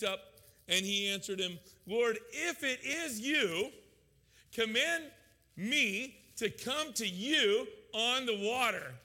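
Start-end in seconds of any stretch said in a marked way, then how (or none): 1.9-8.9 loud, slow, higher call across the water; yelling out. Retold or imitated. Peter calls out to Jesus from the boat, slow loud yell. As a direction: call out boldly to Jesus over the storm. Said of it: "he yells out".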